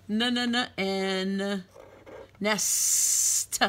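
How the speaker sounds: repeated "N" hummed, then "nest" almost whispered; sounding out nest. Shown as a demonstration. Teacher to children, sounding out "nest". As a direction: hum the N, then breathe "nest". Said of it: "I hear the T and hear the S".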